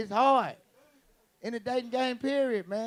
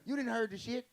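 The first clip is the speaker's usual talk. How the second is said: usual speaking voice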